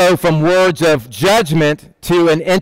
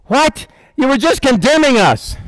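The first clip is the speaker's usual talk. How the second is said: high, startled what; mock disbelief; 9 semitones higher than usual. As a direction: act thrown off, pitched up. Mock reaction to Jesus' prayer.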